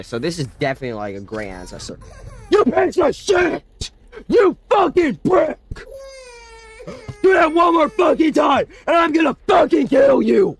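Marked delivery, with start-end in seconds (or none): 2.5-10.6 furious scream. Real, not acted. Gamer screaming threats at the baby, furious.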